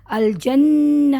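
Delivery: الجنہ slowly drawn, ending in a breathy ہ; pausal recitation. Shown as a demonstration. Teacher showing the pause on الجنہ to students. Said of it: "تھوڑا سا جھٹکا آتا ہے".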